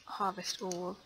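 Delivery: normal talking voice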